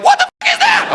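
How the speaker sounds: high, shrieking panic at the sight; terrified shriek. Retold or imitated. Woman with the phobia seeing a little person, shrieking. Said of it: "It's more like".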